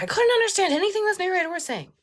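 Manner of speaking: high, rushed mock complaint; mock complaining reviewer. Imitates complaining audiobook reviewer.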